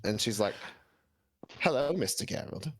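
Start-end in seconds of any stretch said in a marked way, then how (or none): 1.6-2.7 put-on a light British accent, purring the greeting; lightly voiced British seductress. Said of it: "she's British".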